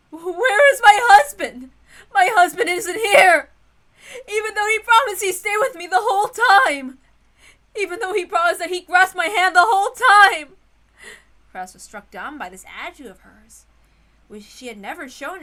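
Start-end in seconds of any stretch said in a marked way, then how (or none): 0.1-10.6 high, loud, tearful wailing; panicked crying. Acted. Feverish Ava crying out for her missing husband. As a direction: wail for your husband in panic. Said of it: "was calling out for her husband".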